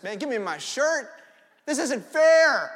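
put-on loud, slow, hurt protest from a little boy; upset kid protest. Preacher as a little boy, wronged over the shirt, wailing loudly. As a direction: wail it like a wronged child. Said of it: "I'm feeling the sense of injustice".

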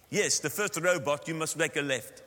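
put-on firm Afrikaans-accented English; insistent Afrikaner accent; South African accent. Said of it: "Now that's a South African accent of an Afrikaans".

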